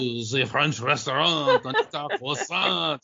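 hammed-up French accent, commercial pitch; French restaurant advert, exaggerated accent; mock French accent. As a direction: lay on a thick French accent. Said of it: "they speak English with this French accent".

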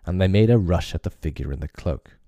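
ordinary delivery

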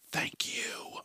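sarcastic whispered thanks; dry whispered thank you. Real, not acted. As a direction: breathe it out under your breath. Sarcastic aside after ranting.

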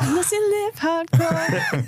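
catchphrase. Retold or imitated. Finishing the catchphrase.

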